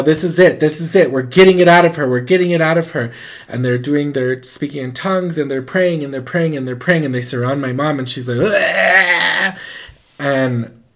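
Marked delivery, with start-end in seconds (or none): 0.0-3.1 loud, driving repeated chant of alarm; retold frenzied church helpers; urgent exorcism cry. Acted frantic exorcism crowd. 8.4-9.6 loud, drawn-out mock retch; pitched-up vomiting noise; imitated retching. Imitates mother throwing up.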